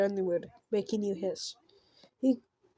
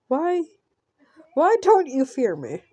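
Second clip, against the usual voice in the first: higher, louder demanding question; exasperated villain; 5 semitones higher, 4 dB louder than usual. Acted. Villain demanding why the victim won't fear him, exasperated. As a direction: plead and demand at once, acted.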